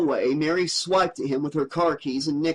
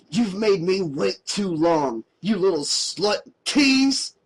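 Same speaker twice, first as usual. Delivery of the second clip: shouted; 4 semitones higher, slower than usual. A narrator's reading. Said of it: "he shouted".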